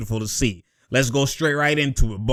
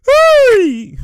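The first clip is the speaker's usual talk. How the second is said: excited yelp; 7 dB louder than usual. Real, not acted. Reacting to a big play.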